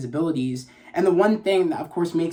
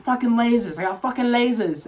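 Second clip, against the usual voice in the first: a hint of a gloating thug, pitched higher; gleeful villain brag; 5 semitones higher than usual. Kano discovering his lasers, lightly voiced gloating brag. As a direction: crow about the new power, lightly voiced. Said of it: "He's like".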